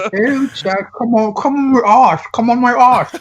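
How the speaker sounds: toothless British lady. Retold or imitated. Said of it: "she had a British accent".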